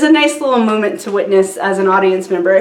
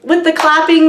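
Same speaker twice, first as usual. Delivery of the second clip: mock pleading; 5 semitones higher than usual. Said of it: "We were like".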